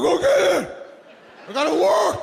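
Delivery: put-on father yelling from bed to his crying kid. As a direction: bark it like an annoyed father woken at night. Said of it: "my father would go".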